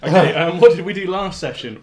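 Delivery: hyped up and loud; buzzing with energy; too much caffeine. Real, not acted. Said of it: "I'm wired on caffeine this evening".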